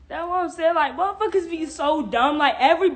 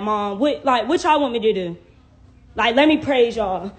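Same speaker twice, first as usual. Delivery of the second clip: normal talking voice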